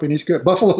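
ordinary delivery

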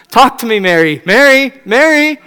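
high, loud, begging husband; pleading Joseph. Retold or imitated. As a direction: beg your upset wife to stop being mad. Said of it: "Joseph saying".

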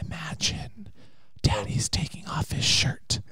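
soft unvoiced ASMR whisper. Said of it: "some lovely A S M R".

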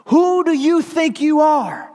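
high, loud, slow outraged shout; furious cry. Acted. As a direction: shout it up at God in fury. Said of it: "she cries out to God".

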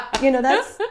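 laughing through the words; high laughing aside. Real, not acted. Laughing at own story.

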